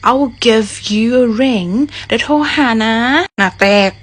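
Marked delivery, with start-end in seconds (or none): 0.1-1.7 slow, clear I'll give you a ring; phrase demo. Shown as a demonstration. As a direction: say the phrase slowly for learners. (พูดประโยคช้าๆ ชัดๆ แบบครูสอน)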